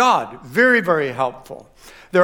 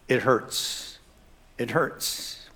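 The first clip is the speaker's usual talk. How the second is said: hurt, quiet; 5 semitones lower, 8 dB quieter, much slower than usual. Retold or imitated. Voicing a hurt reaction.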